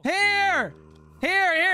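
high shouted call; shouting. Real, not acted. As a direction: call him over to you. Guiding teammate by voice.

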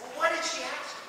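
ordinary talk, nothing marked

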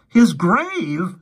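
shocked question. Acted. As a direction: gasp it out in disbelief. Johnny horrified at the idea.

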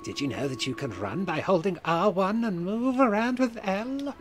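high, slow wailing ghost. Acted. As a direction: moan it like a spirit. Ghostly helper voice.

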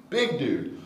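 ordinary talk

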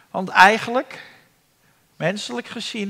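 ordinary delivery

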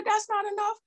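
high-pitched objection from the crowd; high mock-crowd objection; crowd's protest. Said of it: "The people said".

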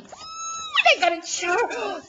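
high squealed ooh into an excited cry; delighted squeal. Real, not acted. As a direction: squeal at a lovely surprise. Delighted at finding a charm.

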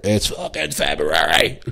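laughing. Retold or imitated.

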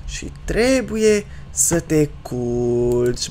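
softening, slowing and dropping low; lulling bedtime talk. Pretend. Gamer sending the child to sleep, mock tender lulling. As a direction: wind down as if putting a baby to sleep. Puts the game child to bed.

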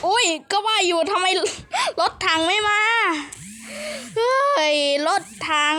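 high wailing realisation, stretched at the end; dismayed outcry. Real, not acted. Player realises the tanks never came, dismayed outcry. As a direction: cry out in alarm and drag out the last words.

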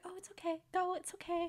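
high, very quiet coaxing; soft reassurance. Retold or imitated. Competitive partner encouraging her on her turn, soft imitation. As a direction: coax gently in a small high voice. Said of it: "He was so soft with Kat".

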